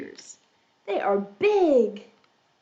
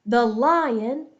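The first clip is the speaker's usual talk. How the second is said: ordinary talk, nothing marked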